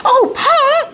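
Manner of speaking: high, loud excited cry. Acted. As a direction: burst out with delight at Pa. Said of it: "Laura cried out".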